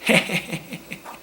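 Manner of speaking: short high breathy laugh; laughing at own impression; breathless laugh. Real, not acted.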